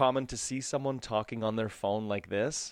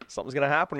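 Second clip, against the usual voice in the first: normal talking voice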